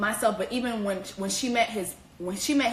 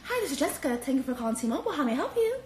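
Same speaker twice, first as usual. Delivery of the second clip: softer, polite call-center greeting; customer service voice; 2 semitones higher, 4 dB quieter, faster than usual. Retold or imitated. Call-center worker answering T-Mobile calls, softer polite voice. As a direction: sound like a phone rep on shift. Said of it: "I had my customer service voice".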